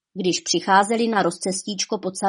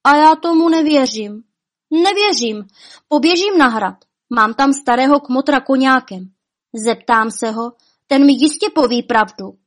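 high, loud, defiant outburst; worked up and determined; agitated refusal; 4 semitones higher, 5 dB louder than usual. A narrator's reading. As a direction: refuse to believe it, voice raised. Upset girl refuses to believe it.

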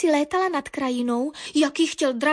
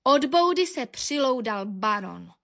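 plain reading voice

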